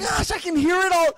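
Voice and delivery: startled, high exclamation; surprised. Real, not acted. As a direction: react in surprise to the noise. Startled by the noise.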